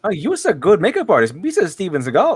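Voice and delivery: high, swooping cartoon-alien voice; goofy singsong mimicry, pitched well up; Jar Jar Binks. Seagal flattering the makeup artist, goofy cartoon impression. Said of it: "He just sounds like Jar Jar Binks".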